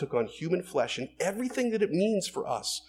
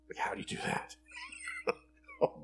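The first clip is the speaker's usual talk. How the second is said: baffled whisper, much softer; whispered aside; 11 dB quieter than usual. Acted. Preacher voicing listeners' puzzlement at the verse, whispered.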